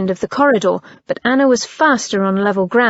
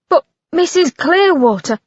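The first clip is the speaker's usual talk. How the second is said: protesting coachman; 6 semitones higher than usual. A narrator's reading. Coachman protesting to Missus Clearwater, lightly voiced. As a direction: object like a tired coachman.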